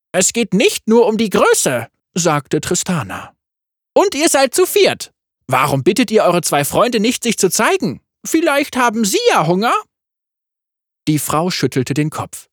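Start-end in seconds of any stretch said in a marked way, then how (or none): 0.1-1.9 Tristana voice. A narrator's reading. 4.0-9.7 high, cheeky yordle voice; playful little gunner; Tristana voice. A narrator's reading.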